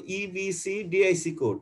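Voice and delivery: ইবিসি, ডিআইসি read as two separate chunks; split into parts. Shown as a demonstration. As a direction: break ইবিসি and ডিআইসি apart with a pause. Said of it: "এটাকে আলাদা আলাদা পড়বে". (ইবিসি আর ডিআইসি আলাদা করে ভেঙে পড়া)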